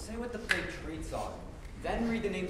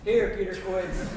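booming answer; 4 semitones higher, 9 dB louder, slower than usual. Acted. Bottom shouting roll call.

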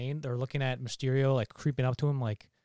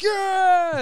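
high, loud mock cheer; crowd cheering; 11 dB louder than usual. Voicing the crew answering Mysterio, mock cheer. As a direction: imitate the crew whooping back. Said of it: "They're like".

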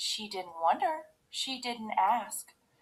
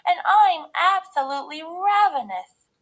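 high, loud little-cub voice; Baby Bear; 8 semitones higher, 9 dB louder than usual. A narrator's reading. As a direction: pipe up loudly as the littlest bear. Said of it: "said Baby Bear".

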